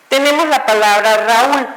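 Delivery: ordinary delivery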